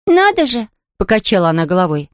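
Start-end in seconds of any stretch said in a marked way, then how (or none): 0.1-0.7 put-on high, wondering child's exclamation; surprised little girl. A narrator's reading. Girl reacting to the invalid's complaint, surprised. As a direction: marvel in a high girlish voice.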